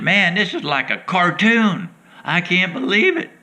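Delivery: high, astonished exclaim; amazed disbelief. Retold or imitated. Mark amazed at the fish.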